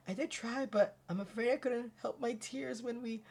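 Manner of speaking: small, high, soft voice; apologetic assistant. Acted. Assistant explains her tears over the bill, apologetic high soft voice. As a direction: confess it softly and high.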